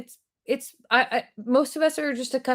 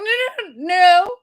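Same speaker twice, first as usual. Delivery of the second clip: higher, louder string of mock-panicked refusals; alarmed refusal; 4 semitones higher, 6 dB louder, slower than usual. Refusing the salon lady's offer of more waxing, mock alarm. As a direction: hurriedly shut the offer down, pitched up.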